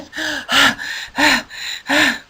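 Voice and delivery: long breathy worn-out sigh; exhausted sigh. Acted. Harold back home worn out, long sigh. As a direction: sigh as if utterly spent. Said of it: "he sounded like this".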